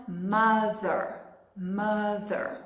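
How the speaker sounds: "mother" slowly, with a clear voiced TH; careful teaching "mother", soft TH in the middle; TH sound. Shown as a demonstration. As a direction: model "mother" with the tongue on the teeth for TH. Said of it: "Aprenda o som do T H".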